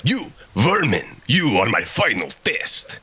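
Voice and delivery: put-on slow, grandiose Russian-accented villain. Kraven challenging Vermin to combat, grandiose Russian accent. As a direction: declaim in a hammy Russian accent. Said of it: "nonsense in a Russian accent".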